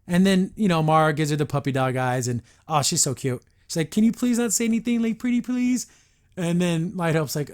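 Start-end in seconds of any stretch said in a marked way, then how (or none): 3.9-5.9 high sweet begging voice; pleading. Retold or imitated. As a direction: beg with puppy dog eyes. Mara begging Light Hope.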